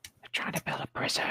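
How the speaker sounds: unvoiced conspiratorial whisper. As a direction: whisper it like a secret. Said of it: "whispered aside".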